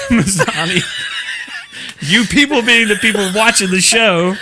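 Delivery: loud, high, laughing clarification; laughing protest. Real, not acted. Host clarifying a phrase to co-host, laughing protest. Amused defence of her wording.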